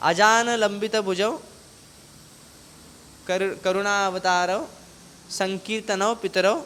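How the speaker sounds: measured, ringing Sanskrit verse; verse recitation. Acted. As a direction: recite the shloka phrase by phrase, with pauses.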